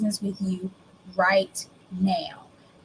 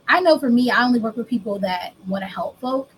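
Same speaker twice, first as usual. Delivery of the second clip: normal talking voice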